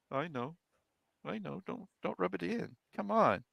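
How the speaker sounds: embarrassed whine. Retold or imitated. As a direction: beg him to stop teasing. Young self whining, embarrassed.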